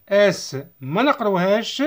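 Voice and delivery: ordinary delivery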